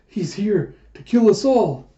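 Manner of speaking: high, loud, drawn-out accusation; alarmed cry. A narrator's reading. Villager warns that the dragon will kill everyone, alarmed cry. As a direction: play a villager in dramatic panic.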